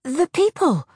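high, loud spluttered words; spluttering. A narrator's reading. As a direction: blurt it out in a panic. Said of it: "Lena spluttered".

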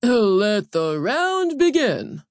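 loud, high, slow arena call; booming ceremonial start signal; overseer's announcement. A narrator's reading. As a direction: proclaim it with a soaring stretched middle. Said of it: "then cleared his throat".

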